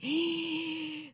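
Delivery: sharp, high intake of breath; awestruck gasp. Acted. Preacher reliving his arrival with flowers, awestruck gasp. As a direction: gasp as if stunned.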